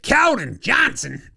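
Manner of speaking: high, drawn-out player introduction; hyped name call. Acted. Host hails Keldon Johnson, hyped name call. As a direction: stretch the name out like an arena announcer.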